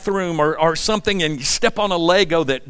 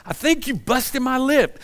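higher, lightly put-on wife voice; a hint of hurt wife, pitched up; wife's voice; 6 semitones higher than usual. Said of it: "And Margie's like".

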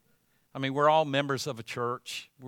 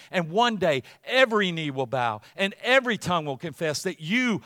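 preaching at full force; 2 semitones higher, 6 dB louder than usual. Acted. Pastor leads the congregation's confession of Christ, proclaimed loudly. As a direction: declare each line like a creed.

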